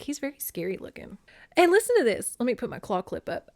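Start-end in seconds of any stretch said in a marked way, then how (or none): 0.0-1.2 softer, hushed and scared; hushed fearful. Retold or imitated. Demeter confiding her fear of Hades, hushed. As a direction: say it like a frightened secret. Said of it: "She's just like".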